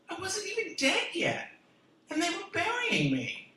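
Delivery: half-comic high outrage; indignant outrage. Acted. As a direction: protest it, wide-eyed and outraged. Outrage at being counted out.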